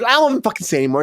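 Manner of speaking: ordinary talk, nothing marked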